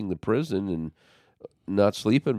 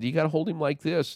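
coaching demonstration; 9 semitones higher, faster than usual. Retold or imitated. Showing David how to hold a baby, high and quick. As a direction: show him gently but briskly.

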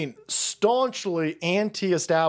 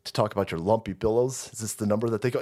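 mock smug lawyer; 8 semitones lower, 3 dB quieter than usual. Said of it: "the fucking shyster lawyer goes".

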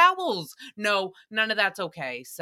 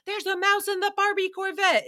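much higher, thin, strained mock panic; Kristen Wiig style; 10 semitones higher than usual. Host redoing Nicole's mouse line like a Kristen Wiig character. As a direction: push it up into a squeaky comic panic. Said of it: "so comparable to Kristen Wiig".

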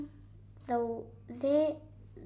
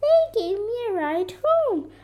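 high, loud little-deer voice; high-pitched excited report; excited baby deer; 11 semitones higher, 8 dB louder than usual. A narrator's reading. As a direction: tell it eagerly at raised volume. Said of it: "shouted Baby Deer".

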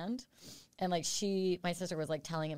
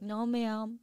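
put-on higher-pitched polite little kid; small child's voice, pitched up; 5 semitones higher than usual. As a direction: imitate a cute, well-mannered child. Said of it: "hearing that from, like, a little kid".

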